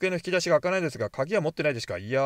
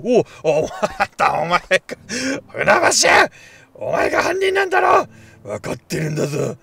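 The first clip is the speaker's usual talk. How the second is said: loud angry shout, peaking high on the accusation; accusing shout; 8 semitones higher, 9 dB louder than usual. Acted. Player confronting Funabashi as the culprit, furious shout. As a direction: call the culprit out at full volume.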